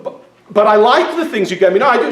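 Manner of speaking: a retold husband's loud protest. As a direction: insist you like her gifts. Protesting to wife in retold argument.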